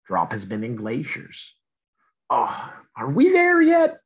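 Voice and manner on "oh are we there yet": much higher, louder whiny question; impatient kid voice. Acted. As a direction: whine like a bored kid on a road trip. Drop stuck in glacier.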